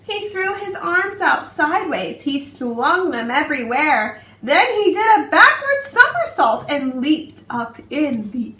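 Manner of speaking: put-on expressive read-aloud for young children; storybook narration. A narrator's reading. Teacher reading a picture book to her class, expressive storytelling. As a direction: tell it like bedtime story time, rhythmic and lively.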